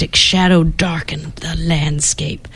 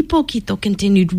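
ordinary delivery, nothing marked

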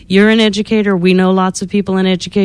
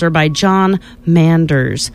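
plain reading voice

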